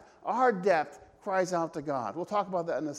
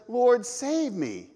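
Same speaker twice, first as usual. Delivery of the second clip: mild fearful cry; 5 semitones higher, 5 dB louder, much slower than usual. Retold or imitated. Peter sinking, crying out.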